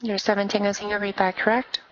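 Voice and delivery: ordinary delivery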